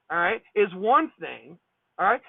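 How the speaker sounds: usual speaking voice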